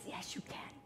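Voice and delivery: a soft whisper of support; whispered encouragement. Acted. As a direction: breathe it like a secret promise. Voicing mentor's encouragement.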